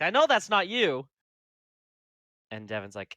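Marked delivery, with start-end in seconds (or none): 0.0-1.1 excited disbelief. Acted. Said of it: "he's like".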